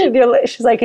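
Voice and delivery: ordinary talk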